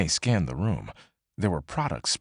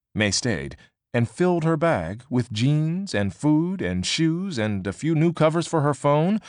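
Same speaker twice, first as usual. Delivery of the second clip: usual speaking voice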